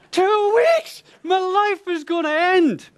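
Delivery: high, loud laughing despair; despairing wail. Retold or imitated. Groom despairing, laughing teller.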